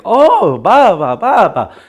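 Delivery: high, loud, bouncing syllables. Shown as a demonstration. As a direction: swoop up high on each mock syllable. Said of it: "talk a little bit higher like that".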